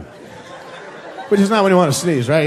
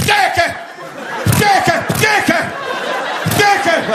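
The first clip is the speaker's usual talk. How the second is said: high-pitched shrieked sneezes; explosive sneezing; slower than usual. Acted. First caveman ever to sneeze among his friends, shrieked sneezes. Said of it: "First guy to sneeze".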